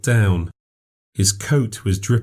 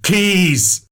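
loud, much higher, blunt bark of a word; 10 semitones higher, 3 dB louder than usual. Acted. Stranger cuts the narrator off, blunt and loud. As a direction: snap the word out hard. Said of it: "he said bluntly".

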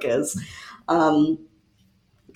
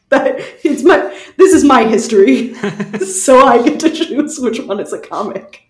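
higher, louder, laughing through the words; laughing defiance; 5 semitones higher, 5 dB louder than usual. Real, not acted. Telling the listeners she decides what counts as a comic, laughing. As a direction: playfully insist it's your call, giggling. Defends her own comic history choice.

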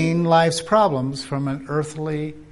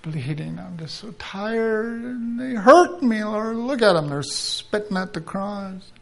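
weary moaning prayer; 4 dB quieter than usual. Acted. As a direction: groan like someone exhausted on a cross.

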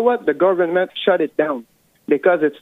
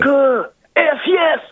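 excited cheer; 7 semitones higher than usual. Real, not acted. Caller cheering America.

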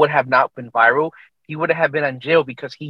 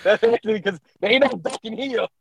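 amused inner voice; 6 semitones higher, 3 dB quieter than usual. Retold or imitated. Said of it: "I'm like".

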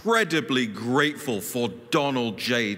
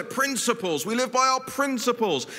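higher, mock-pompous sing-song; mocking Never Trumpers; 7 semitones higher than usual. Speaker mocking opponents' slogan, mock-pompous. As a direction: sneer at their pious slogan. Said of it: "They always say".

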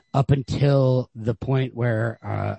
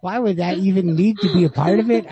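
higher, laughing mock outrage; laughing disbelief; 7 semitones higher than usual. Reader reacting to the desert detail, laughing disbelief. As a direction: scoff at the strange detail through a laugh. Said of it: "I'm like".

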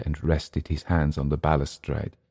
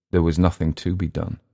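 ordinary delivery

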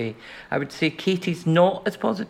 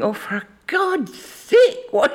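high, slow exasperated sigh of a line; 9 semitones higher, slower than usual. Retold or imitated. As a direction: play the impatient friend, drawn out. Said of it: "Lynn was going".

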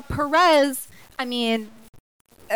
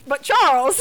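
louder, insistent repeat of the name; emphatic; 3 semitones higher, 5 dB louder than usual. Real, not acted. Cutting in excitedly to bring up Charles. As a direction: push the name to get the floor. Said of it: "repeat, louder".

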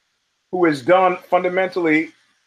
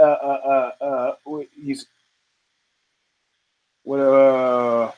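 normal talking voice

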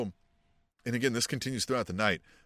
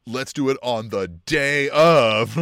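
loud mock challenge; 8 dB louder, slower than usual. Omega quoted, loud and slow.

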